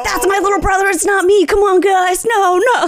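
hammed-up panicked teenager; panicked teen. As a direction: beg the chat it wasn't you. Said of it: "he was like".

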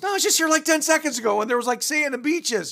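man complains to the devil, indignant; protesting. Acted. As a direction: insist it was a beach ten seconds ago.

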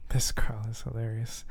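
quiet whisper to the audience; whispered aside. Real, not acted. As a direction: whisper it under your breath.

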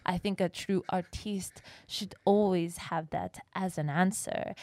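put-on a light French accent held throughout; sustained French accent. Quoting the French climber to friends, light French accent. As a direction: play the French artiste, slow and earnest. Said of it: "you didn't have to say it in the accent".

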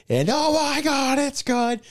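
high, loud, drawn-out play-by-play scream; announcer's ecstatic call. Retold or imitated. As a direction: explode as the winning kick goes through. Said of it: "the guy was like".

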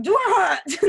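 high, loud startled asking; a hammed-up little yelp of a question; mock alarmed question. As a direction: pretend to poke yourself and wonder. Acting out poking and asking.

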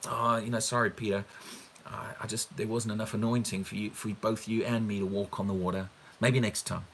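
low, soft imitation of a lame excuse; mock apology. Preacher imitating Jesus excusing failure to Peter, mock apologetic. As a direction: half-heartedly let someone down, imitating sheepishness.

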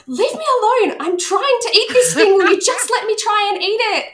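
retold outburst at her partner while eating; snapping. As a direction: lash out at the partner, fed up. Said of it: "And I just snapped".

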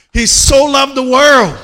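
high, loud, slow preached line; shouted preaching. Acted. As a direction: belt it out to the whole church. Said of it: "preaching climax vs calm repeat".

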